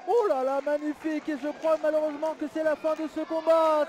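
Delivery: fired-up live play-by-play call; wrestling commentator. Acted. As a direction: call every move like ringside TV.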